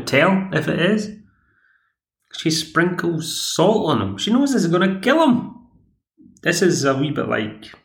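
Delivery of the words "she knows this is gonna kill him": incredulous telling. Real, not acted. Retelling wife's cruel test.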